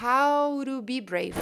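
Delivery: slow "How to be brave" as "hauro bi breiv"; connected speech. Shown as a demonstration. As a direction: link the words slowly, R for the T. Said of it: "a frase vai ficar".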